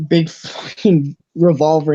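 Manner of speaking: usual speaking voice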